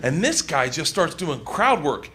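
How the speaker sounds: ordinary talk, nothing marked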